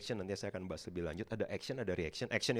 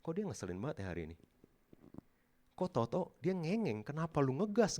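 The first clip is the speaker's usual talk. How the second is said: annoyed inner complaint; 6 semitones higher than usual. Retold or imitated. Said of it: "ada orang yang kayak".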